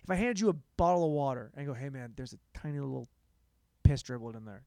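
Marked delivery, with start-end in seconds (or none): none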